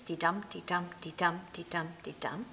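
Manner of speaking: "de-dum" with a weak "de" and a strong "dum"; iambic beat. Shown as a demonstration. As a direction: tap out "de-dum" evenly, leaning on each "dum". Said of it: "five strong stresses".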